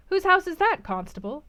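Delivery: a hint of a gentleman hailing the constable, then dropping; elder gentleman asking. A narrator's reading.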